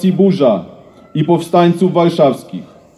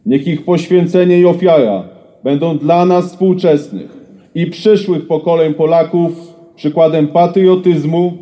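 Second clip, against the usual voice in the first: ordinary delivery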